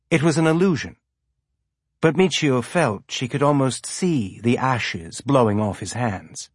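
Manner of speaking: ordinary delivery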